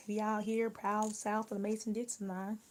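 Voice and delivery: ordinary delivery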